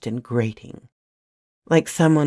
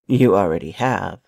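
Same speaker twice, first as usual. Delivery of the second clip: much lower, cold and menacing; dark tone; 7 semitones lower than usual. A narrator's reading. Alex facing the intruder, low dark menace. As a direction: drop the voice into a threat. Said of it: "His tone was dark".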